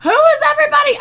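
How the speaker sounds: high, loud cry of confusion; flustered high yell; exasperated squeal. Real, not acted. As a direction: squeal it in frustrated delight. Can't tell members apart.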